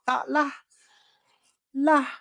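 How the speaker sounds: high, ringing pitch on "lah". Shown as a demonstration. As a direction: lift "lah" high above the voice. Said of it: "that is a high tone".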